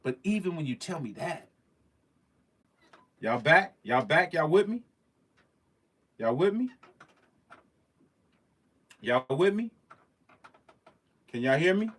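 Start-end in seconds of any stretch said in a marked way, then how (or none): 3.2-11.9 loud, high checking calls; calling out to viewers. Real, not acted. Streamer checking viewers after stream froze, calling out loudly. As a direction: call out to see if they're still there.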